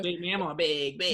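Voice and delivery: high, teasing mock Wisconsin "bag"; kids teasing Wisconsin accent. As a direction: tease mom by stretching "bag" the Wisconsin way. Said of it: "my kids are always like".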